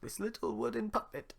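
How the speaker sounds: put-on high, old toymaker; Geppetto. Geppetto sells Pinocchio to the guard, in a high voice. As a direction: offer the puppet.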